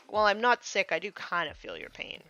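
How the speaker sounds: ordinary delivery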